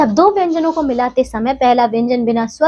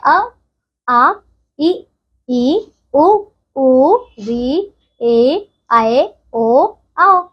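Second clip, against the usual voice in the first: slow, each vowel drawn out with a pause after it; slow vowel recitation; much slower than usual. Shown as a demonstration. Teacher reciting the Hindi vowels slowly to children. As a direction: recite the vowels for the class to repeat. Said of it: "अ से लेकर आओ तक".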